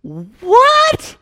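shrill high startled cry; David reacting to the Lord striking a man, shocked yelp; David's shocked what. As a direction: yelp it in outrage. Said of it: "David's response is".

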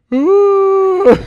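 very loud, high, long wail; comic outburst of panic; mock wail. Father told the baby is coming now, mock wail.

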